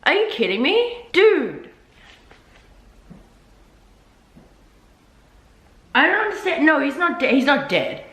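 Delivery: high, rising disbelief; can't believe the character just died; stunned outrage. Real, not acted. Reader learning Sirius died, high shocked protest. Sirius dies, she reacts.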